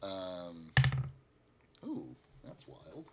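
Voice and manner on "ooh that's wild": usual speaking voice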